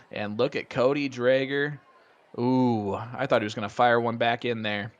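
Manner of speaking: ordinary talk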